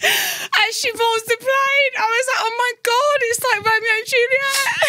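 very high, squeaky voice cracking up with laughter; helpless high squeal. Real, not acted. Friend retelling a Romeo and Juliet farewell, squealing hysterically.

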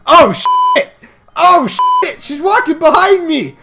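high, loud panicked cries; game panic. Real, not acted. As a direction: build into a panicked yell. Panics at enemy behind in game.

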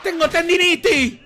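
stretched-out high-pitched protest; game outburst. Real, not acted. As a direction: complain at the top of your voice. Outburst while fighting in game.

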